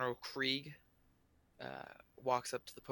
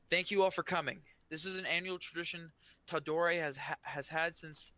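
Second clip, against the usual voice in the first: raised, projected speech to a crowd; booming voice; 5 semitones higher, 4 dB louder than usual. Acted. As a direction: address the whole square from the podium. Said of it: "the voice booms".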